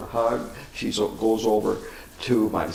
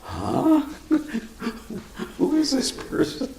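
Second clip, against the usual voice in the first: mock bewildered. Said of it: "my sister and I were kinda going".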